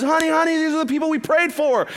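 high, loud, frantic imitation; freaking out. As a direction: imitate her freaking out, pitch way up. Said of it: "She starts freaking out".

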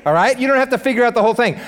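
ordinary talk

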